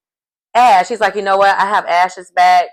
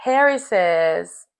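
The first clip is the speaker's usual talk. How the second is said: usual speaking voice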